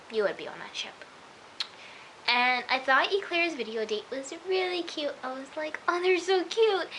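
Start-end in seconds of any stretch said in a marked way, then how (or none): 5.9-6.9 higher, drawn-out fangirl coo; gushing. Retold or imitated. Viewer cooing over a couple's video date, gushing. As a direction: gush over them, stretching it out.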